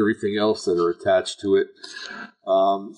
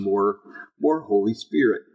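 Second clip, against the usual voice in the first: ordinary talk, nothing marked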